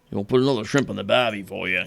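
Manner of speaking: a lightly put-on Australian accent, teasing the cliché; hammed-up Aussie tourist-ad Australian accent; playful mock Australian accent. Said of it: "no one talks like that".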